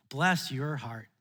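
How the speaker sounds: slow, low Southern drawl on "Bless your heart"; a sugary Southern lady, slow and low. Retold or imitated. A Southerner to a visitor, slow mock-sweet drawl. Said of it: "somebody's gonna look at you and say".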